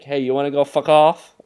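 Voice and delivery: loud Boston heckle, hammed up; mock Boston tough guy. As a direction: bark it like a Boston local picking a fight. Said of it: "So, like".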